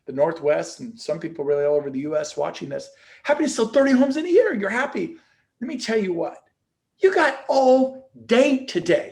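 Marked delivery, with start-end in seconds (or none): 3.2-9.1 fired up. Real, not acted. Challenging agents to sell more.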